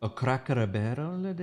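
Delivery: poorly faked Japanese accent; bad Japanese accent. Host misreads co-host's request for an accent, bad Japanese accent. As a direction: put on a clumsy mock Japanese accent. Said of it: "I did an insulting Japanese accent".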